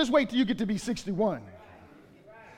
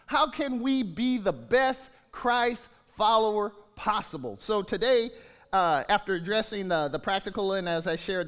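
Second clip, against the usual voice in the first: ordinary delivery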